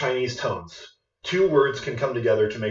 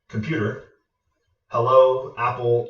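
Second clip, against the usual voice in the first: ordinary talk, nothing marked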